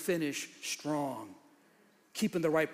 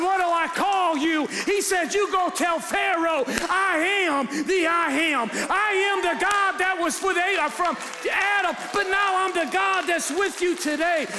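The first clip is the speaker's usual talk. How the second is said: higher and louder, driving hard; preaching at full force; 9 semitones higher, 4 dB louder than usual. Real, not acted. Pastor preaching at full force to the congregation about his truth. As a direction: shout it from the pulpit like a climax.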